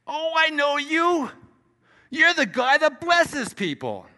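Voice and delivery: very high, loud, drawn-out mock greeting; excited churchgoer imitation. Man in church recognising the preacher, hammed-up excitement. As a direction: imitate someone loudly recognising you, stretched out. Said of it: "I got approached by a guy in church".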